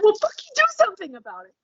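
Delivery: high, fast mock exasperation; hammed-up impatient comeback; exasperated retort. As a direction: snap back in a squeaky high pitch. Exasperated retort to imagined complainer.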